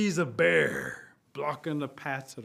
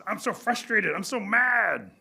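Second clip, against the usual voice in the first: loud, high acted outburst; frustrated anger; 4 semitones higher, 5 dB louder, slower than usual. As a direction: vent it, building on mad. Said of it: "get so mad they begin to shake things".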